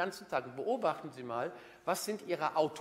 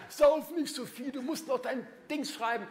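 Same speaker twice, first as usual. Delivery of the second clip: nagging inner voice; 11 semitones higher, 5 dB louder than usual. Retold or imitated. The inner chatterbox scolding him for drinking, high and nagging. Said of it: "Da kommt nicht immer noch einer".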